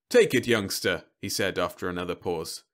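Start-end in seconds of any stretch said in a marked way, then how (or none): 0.1-1.1 robot salesman voice. Acted. Robot coaxes a child.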